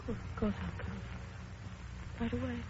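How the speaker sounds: low, soft, hushed with dismay; barely holding together at bad news; stunned and crushed. Myra on the phone hearing orders changed, crushed and quiet.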